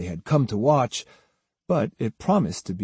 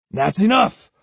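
higher, indignant outburst; angry interruption; 8 semitones higher, 4 dB louder than usual. A narrator's reading. Samurai stopping the swordsman's speech, indignant. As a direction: cut him off sharply.

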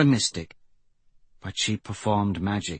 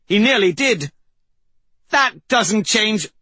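put-on heated young woman; 11 semitones higher, 6 dB louder than usual. Aryan arguing back.

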